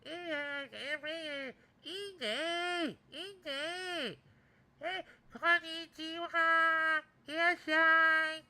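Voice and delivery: very high, squealing nasal impression; drawn-out high nasal mimicry; nasally voice. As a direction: imitate her in a piercing nasal pitch. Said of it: "she talked like that".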